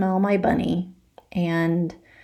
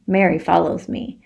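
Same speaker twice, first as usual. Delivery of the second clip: ordinary delivery, nothing marked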